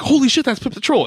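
excited fan gasp. Retold or imitated. Fan spotting a cameo in the theater, high excited outburst. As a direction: blurt it out like a delighted fan. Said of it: "you're like".

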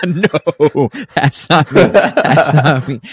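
high, loud laughing no; real laughter through the words; laughing denial. Real, not acted. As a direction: laugh it off, that's not me.

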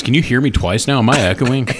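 usual speaking voice

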